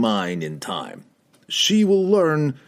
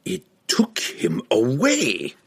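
slow, higher outraged cry; a restrained roar of outrage; mild angry roar; 6 semitones higher, slower than usual. A narrator's reading. Pook realising Regis escaped, mild outraged roar. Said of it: "Pook roared".

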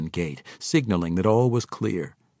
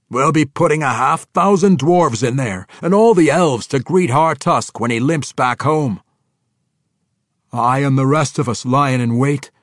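high, loud war-council brogue; commanding dwarf king; 6 semitones higher, 10 dB louder than usual. Acted. Dwarf kings planning an ambush, loud and forceful. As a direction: lay out the battle plan loudly as a dwarf.

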